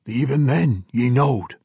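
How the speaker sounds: ordinary talk, nothing marked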